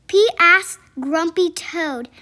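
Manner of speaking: plain reading voice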